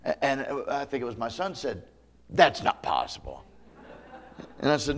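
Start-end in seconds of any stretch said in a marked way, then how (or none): 2.3-3.4 low, doubting voice of a son; the son answering his father, low and doubting; son's retort. Retold or imitated.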